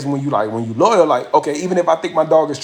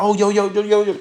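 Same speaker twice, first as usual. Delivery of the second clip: urgent intervention; 5 semitones higher than usual. Retold or imitated. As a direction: imitate a crowd stepping in, high and rapid-fire. Crowd breaking up a brewing fight.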